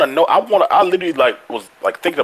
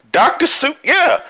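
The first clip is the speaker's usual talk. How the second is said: usual speaking voice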